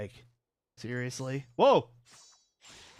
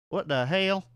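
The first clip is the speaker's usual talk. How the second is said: southern twang, "hell" stretched to "hail"; lightly southern, a touch higher; southern-accented bewilderment; 5 semitones higher than usual. Shown as a demonstration. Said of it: "far more of a southern accent".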